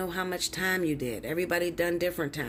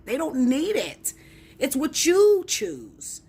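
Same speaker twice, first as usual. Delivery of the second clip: emphatic; 8 semitones higher, 6 dB louder, slower than usual. Real, not acted. Heated rant.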